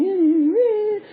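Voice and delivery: high, needling puppet whine; irritating Joey. Acted. Joey pesters Punch with little tricks, irritating high voice. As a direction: tease in a high repeated squeal. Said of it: "But he's very irritating".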